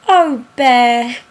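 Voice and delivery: loud, joyful storybook exclamation; happy cry. A narrator's reading. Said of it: "with a happy cry of".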